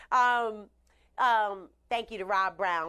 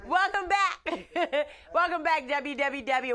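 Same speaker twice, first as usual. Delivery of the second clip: laughing welcome; 5 semitones higher than usual. Real, not acted. Host laughing, greeting live viewers.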